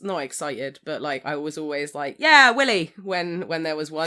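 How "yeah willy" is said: loud, high mock cheer; shouted cheer. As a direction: cheer it out like an excited viewer. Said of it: "I shout it out when I see one".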